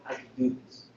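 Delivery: soft, low audience thought "I can do that"; quiet inner voice. Retold or imitated. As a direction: mutter it to yourself, confident. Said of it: "make people feel".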